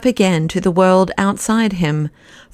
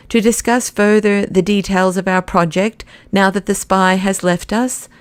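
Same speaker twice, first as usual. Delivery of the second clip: ordinary delivery, nothing marked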